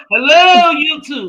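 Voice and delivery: high, loud mock greeting; imitating Uncle Tim. Friend mimicking Uncle Tim opening his live, high sing-song hello. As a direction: call out hello like a goofy streamer. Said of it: "Uncle Tim go".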